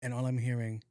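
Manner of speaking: normal talking voice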